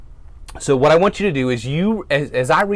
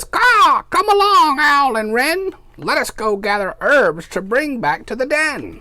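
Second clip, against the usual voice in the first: much higher bird voice with a loud caw; raven cawing; 13 semitones higher, 4 dB louder, slower than usual. Acted. Raven calls Owl and Wren to gather herbs, high cawing bird voice. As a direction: squawk it like a bossy raven. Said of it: "Raven says".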